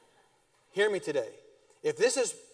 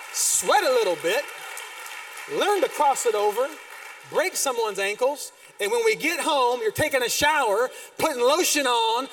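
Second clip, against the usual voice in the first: loud, driving preacher's cadence, each phrase hammered; preaching at full force; 7 semitones higher, 5 dB louder than usual. Acted. Pastor rallying parents of boys, loud preaching. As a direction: pound every line home like a revival.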